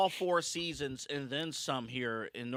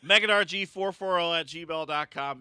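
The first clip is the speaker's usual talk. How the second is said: loud; 5 semitones higher, 5 dB louder than usual. Shown as a demonstration. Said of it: "repeats email plug louder".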